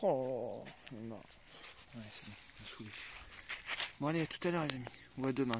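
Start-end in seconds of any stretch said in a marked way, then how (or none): none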